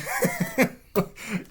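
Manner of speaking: high-pitched burst of laughter. Real, not acted. Laughing at the scene.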